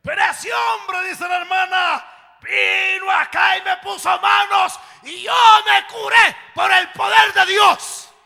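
high, loud preaching shout; full-force preaching. Retold or imitated. Preacher shouting the pastors' testimony to the church. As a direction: preach it at the top of the voice.

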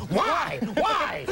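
high, exasperated mock yell; indignant high-pitched outburst; Regis Philbin. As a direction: holler it as a hammed-up celebrity impression. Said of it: "whenever you pass Regis in the street".

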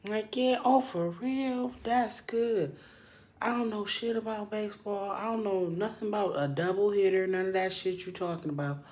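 high, bright put-on interest; fake friendly. Imitating herself faking interest in the boss's baseball talk. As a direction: play along sweetly, faking enthusiasm. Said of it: "I'll be phony".

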